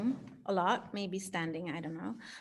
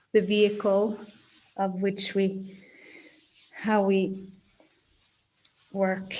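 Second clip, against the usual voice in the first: guided meditation. Acted. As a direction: gently guide them into their body.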